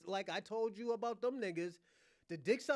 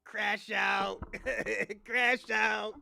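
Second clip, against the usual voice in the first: taunting shout; 4 dB louder, much slower than usual. Real, not acted. Taunting chant at him.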